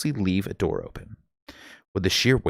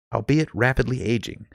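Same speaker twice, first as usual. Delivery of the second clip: usual speaking voice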